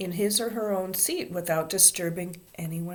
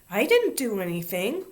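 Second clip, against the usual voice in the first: higher, louder protest; indignant; 4 semitones higher, 5 dB louder than usual. A narrator's reading. Ramona accused of popping buttons, indignant. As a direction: deny it with offended innocence. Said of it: "she said indignantly".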